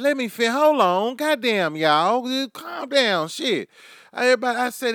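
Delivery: high, loud call for the crowd to settle; shouting over laughter. Real, not acted. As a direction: raise your voice over a roaring room, half laughing.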